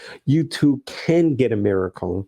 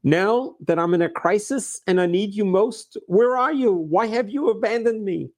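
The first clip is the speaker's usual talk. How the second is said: anguished cry out; 8 semitones higher than usual. Retold or imitated. Abandoned man questions God in his crisis, crying out. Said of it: "he screams out".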